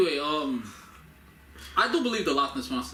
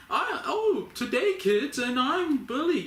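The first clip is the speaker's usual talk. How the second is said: put-on cheery kids host; 11 semitones higher, slower than usual. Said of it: "switch back".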